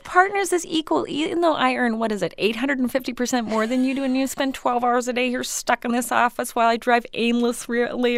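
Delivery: high, chummy boss voice; fake-friendly female boss. Boss inviting her secretary to a party, high fake-friendly voice. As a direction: gush like the boss who plays your pal. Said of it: "a female boss who acts like they're your friend".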